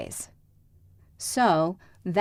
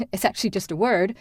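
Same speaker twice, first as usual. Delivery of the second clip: ordinary delivery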